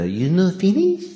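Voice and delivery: a hint of a Japanese accent; a mild Japanese accent on the question; light Japanese accent. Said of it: "I have to do the accent".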